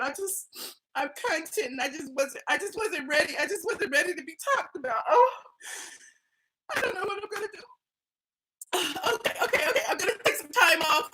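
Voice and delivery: hammed-up tearful meltdown; dramatic reenactment. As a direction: play the overwhelmed victim, mock crying. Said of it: "that's my dramatic reenactment".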